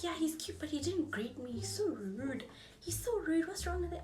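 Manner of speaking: lightly voiced annoyed friend. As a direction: complain like the offended best friend.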